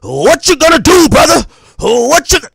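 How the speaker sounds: loud, high wrestler yell; wrestling promo. Retold or imitated. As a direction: shout it like a pro wrestler. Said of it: "Ditch your wrestler voice".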